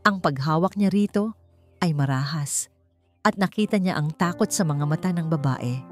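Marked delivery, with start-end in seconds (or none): none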